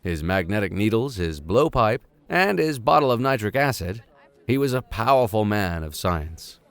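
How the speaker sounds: measured storybook reading voice; story narration. A narrator's reading. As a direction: read it like a classic adventure novel. Reads novel aloud as narrator.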